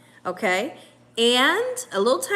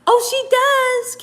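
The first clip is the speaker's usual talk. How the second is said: high, slow, louder oh of surprise; surprised; 12 semitones higher, 6 dB louder, slower than usual. Real, not acted. Reading a viewer's comment, real surprise. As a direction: caught off guard, delighted. Reacting to live chat.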